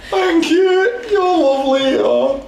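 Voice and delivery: high teary NPC voice. Acted. Tearful NPC laughing voice.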